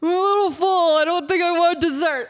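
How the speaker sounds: lightly voiced, full friend with a laugh; mocking friends. Imitating a stuffed friend skipping dessert, sheepish and light. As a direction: mimic the friend's sheepish excuse. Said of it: "and then they say".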